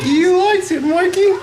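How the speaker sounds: high laughing bird voice; squeaky bird voice. Pretend.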